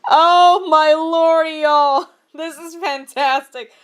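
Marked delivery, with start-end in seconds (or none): 0.1-2.1 slow, loud drawn-out exclamation; amused disbelief. Real, not acted. Reader telling viewers about the awful fic, drawn-out exclamation. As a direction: marvel at it to the audience. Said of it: "laughing disbelief to audience".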